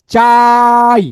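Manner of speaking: loud, sustained vowel in চাই; চাই held long. Shown as a demonstration. Teacher proving he can hold it as long as he likes, চাই held long. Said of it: "যতক্ষণ খুশি".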